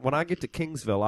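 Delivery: Baltimore accent. Shown as a demonstration. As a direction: say it like a Baltimore local. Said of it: "this is my Baltimore accent".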